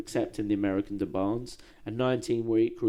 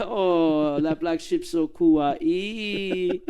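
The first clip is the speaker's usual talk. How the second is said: higher and slow, gushing over the cute ship; cutesy cooing; 6 semitones higher, 3 dB louder, much slower than usual. Real, not acted. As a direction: drag it out like a doting fan.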